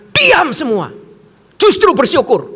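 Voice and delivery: loud, high order to a crowd; shouted command. Retold or imitated. Preacher hushing frightened pupils in the dark, shouting. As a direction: cut through the noise with force. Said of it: "suka teriak-teriak".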